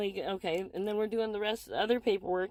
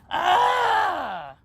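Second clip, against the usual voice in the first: loud, high scream of frustration; frustrated scream; 7 semitones higher, 8 dB louder than usual. Real, not acted. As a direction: let out an exasperated yell. Venting frustration after the story.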